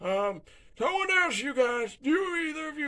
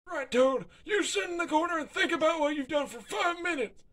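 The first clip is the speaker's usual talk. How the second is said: usual speaking voice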